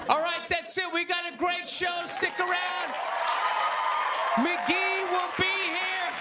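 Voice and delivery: show host sign-off. Acted. Comic wrapping up to the audience like a talk show host. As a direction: send the crowd to the break.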